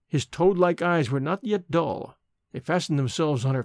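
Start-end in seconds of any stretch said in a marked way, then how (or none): none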